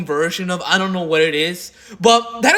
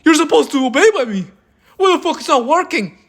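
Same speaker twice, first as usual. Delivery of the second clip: much higher, sputtering mock outrage; mock shocked disbelief; 6 semitones higher, 3 dB louder than usual. Mocking the man whose threat failed, high sputtering shock. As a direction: act baffled that the order failed. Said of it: "And Brony Fan was like".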